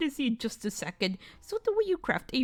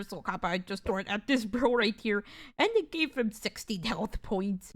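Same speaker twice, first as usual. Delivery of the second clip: normal talking voice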